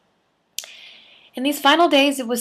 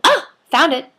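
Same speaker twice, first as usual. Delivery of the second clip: loud, high burst of triumph; Libby under the couch, high and loud triumph; triumphant find; 10 semitones higher, 7 dB louder than usual. A narrator's reading. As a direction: shout it, thrilled to find it. Libby finds the piece.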